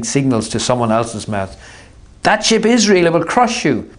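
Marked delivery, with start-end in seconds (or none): 2.2-3.9 urgent warning. Acted. Holly warns Artemis.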